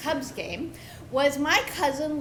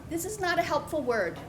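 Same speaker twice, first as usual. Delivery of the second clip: usual speaking voice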